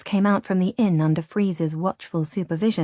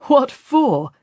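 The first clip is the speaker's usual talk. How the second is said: higher, louder scornful question; mock amusement; 8 semitones higher, 6 dB louder than usual. A narrator's reading. Captain mocks the priest's offer, abrupt and high. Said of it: "Radu laughed abruptly".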